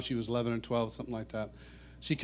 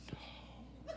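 barely audible retold "no", unvoiced breath; mouthed dismay; 19 dB quieter than usual. Kid realises his mistake, near-silent dismay. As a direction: mime a horrified no with almost no voice. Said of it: "He's going".

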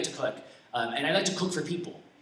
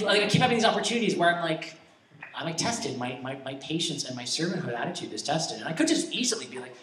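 ordinary talk, nothing marked

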